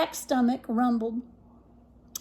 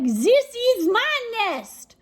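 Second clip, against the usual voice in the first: high, slow possessive cry; 9 semitones higher, 4 dB louder, much slower than usual. Acted. Duck on the goose's back, claiming the nest high. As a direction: stretch it out high and insistent.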